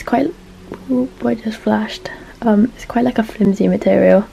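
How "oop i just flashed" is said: whispering. Real, not acted. Startled whispered aside.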